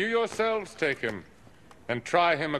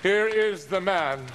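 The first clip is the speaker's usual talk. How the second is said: raised, higher voice presenting the prisoner; calling out to the crowd; 4 semitones higher than usual. Acted. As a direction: governor showing the prisoner to the mob. Pilate presents Jesus to crowd.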